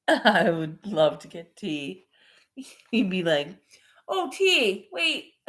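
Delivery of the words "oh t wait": high, sing-out mock call; calling someone over. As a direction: catch T's attention, pitched up.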